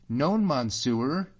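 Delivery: badly pronounced French, high and slow; bad French accent. Sailor denies speaking English to Allan, incredibly bad French accent. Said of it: "with an incredible bad accent".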